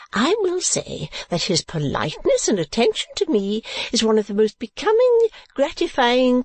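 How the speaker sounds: high matronly protest turning to gushing; indignant, effusive mother. A narrator's reading. Mother defending her praise to Kate, effusive.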